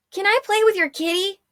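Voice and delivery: put-on high, innocent little-boy voice; piping childlike voice. A narrator's reading. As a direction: play dumb like a small child. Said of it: "piped Mavic".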